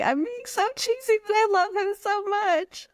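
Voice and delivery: high, voice cracking with real tears; fighting back tears over her husband; tearful, voice breaking. Real, not acted. Guest gushing about her husband, voice breaking into tears. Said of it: "dude, I'm gonna cry".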